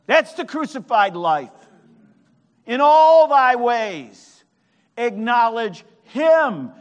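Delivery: loud, high, slow declaiming of scripture; preaching at full force. Real, not acted. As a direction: draw out each key word and ring it out like a preacher.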